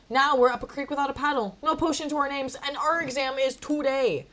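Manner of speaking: high, loud dramatic lament; exasperated. Acted. Narrator has no potion on exam day, exasperated. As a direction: build up the hopeless list with force.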